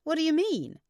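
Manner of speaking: high, loud, incredulous question; exclaiming. A narrator's reading. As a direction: exclaim it to a friend in disbelief. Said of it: "exclaimed Gabby".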